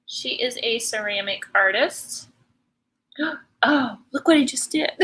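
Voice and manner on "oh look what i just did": gasp, then a higher, louder oh; startled by her own slip; surprised gasp. Real, not acted. Paint slip caught on camera.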